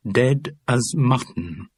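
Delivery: slow, rhythmic list entry; measured headword in a recited list; catalogue rhythm. A narrator's reading. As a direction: read it off like a catalogue. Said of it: "You're getting a rhythm to the words".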